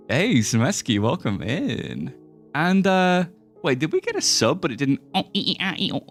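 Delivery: ordinary talk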